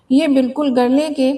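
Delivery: ordinary delivery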